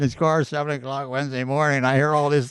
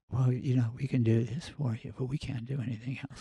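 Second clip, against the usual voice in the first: imitating a very soft-spoken salesman; tiny, hushed mock voice; barely audible talker; 8 dB quieter than usual. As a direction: drop far down, almost inaudible. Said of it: "here's how he talks".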